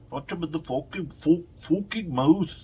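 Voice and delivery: high, loud, badly faked Irish accent; Conor McGregor. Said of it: "can't do a fucking Irish accent".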